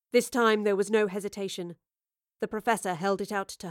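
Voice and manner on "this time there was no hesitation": ordinary delivery, nothing marked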